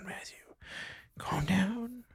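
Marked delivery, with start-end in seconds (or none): 1.2-2.1 hushed whisper, high and soft. Acted. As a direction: whisper it to calm him down. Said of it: "You calm down".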